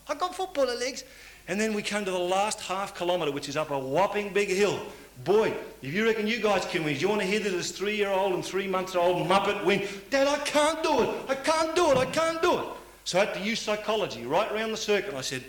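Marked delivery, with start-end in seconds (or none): 0.1-1.0 higher lightly voiced toddler; a hint of a small boy's pride; proud little boy. Child repeats proudly. 10.1-12.7 put-on much higher, louder child's wail; whining toddler. Toddler whines at the hill.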